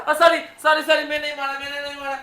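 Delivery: high, repeated frantic begging; panicked pleading. Acted. Husband begging his wife not to hit, panicked pleading. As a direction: beg to be spared the beating.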